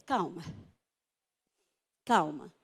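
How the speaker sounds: low, measured hush; calming doctor. Retold or imitated. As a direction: settle the patient down, pitched low. Doctor calming her, retold.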